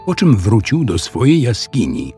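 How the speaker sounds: ordinary delivery, nothing marked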